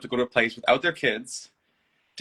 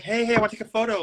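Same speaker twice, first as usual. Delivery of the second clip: playful photographer; 10 semitones higher, faster than usual. Retold or imitated. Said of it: "It was like".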